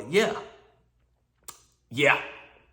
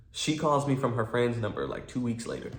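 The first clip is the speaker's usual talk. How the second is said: normal talking voice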